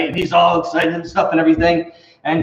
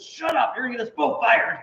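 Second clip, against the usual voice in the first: hushed lion; 6 semitones higher, 6 dB quieter than usual. Acted. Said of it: "the lion looks at him and says".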